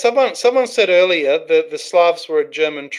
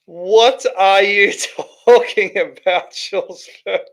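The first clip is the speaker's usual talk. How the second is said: slow, higher question broken by a real laugh; amused baffled laugh at the chatter; laughing disbelief; 3 semitones higher, slower than usual. Real, not acted. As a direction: laugh at the nonsense as you ask. Laughing at the odd message.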